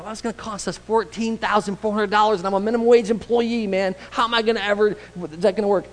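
high, disbelieving complaint; incredulous disciple. Retold or imitated. Disciple protesting the cost to Jesus, incredulous. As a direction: balk at the price in disbelief.

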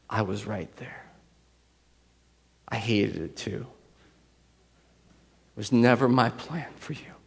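soft, gentle reassurance, partly hushed; tender voice of God. Retold or imitated. God answering the grieving speaker, softly and tenderly. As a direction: comfort a hurting child. Voicing God's reply, softly.

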